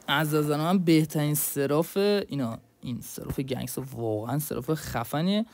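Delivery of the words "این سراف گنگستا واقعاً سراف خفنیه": ordinary talk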